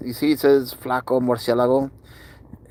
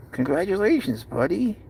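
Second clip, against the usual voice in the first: higher, doting pet voice; cooing congratulations; 5 semitones higher, 3 dB quieter than usual. Real, not acted. Owner to his cat after first hiss, high cooing.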